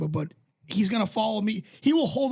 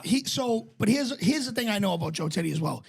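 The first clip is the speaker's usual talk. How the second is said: ordinary delivery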